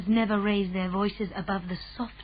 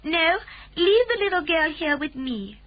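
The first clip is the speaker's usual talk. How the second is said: a lightly voiced calm Queen, higher; gracious royal command, a touch higher; 8 semitones higher, 4 dB louder than usual. A narrator's reading. Queen speaks.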